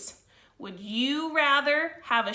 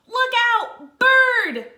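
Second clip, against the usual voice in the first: much higher, much louder alarm cry; panicked warning; 12 semitones higher, 12 dB louder, much slower than usual. Acted. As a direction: shout the warning before the bird strikes. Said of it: "cried the snails in panic".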